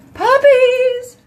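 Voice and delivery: high, loud squeal of delight; gleeful high shout; excited squeal. Real, not acted. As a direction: squeal it like you can't contain yourself. Gushing about coming puppies.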